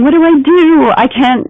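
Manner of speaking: high, loud, panicky self-talk; stressed inner panic. Retold or imitated. Stressed person in a heated meeting, panicky and high. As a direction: voice the frantic thoughts of someone stuck. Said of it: "locked in stress, thinking".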